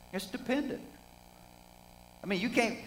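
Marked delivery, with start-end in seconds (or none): none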